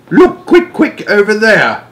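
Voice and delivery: high, urgent giant voice; hushed excitement of a kindly giant; giant's call. A narrator's reading. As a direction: call out eagerly as the big friendly giant. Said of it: "he said".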